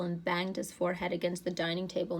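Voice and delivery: usual speaking voice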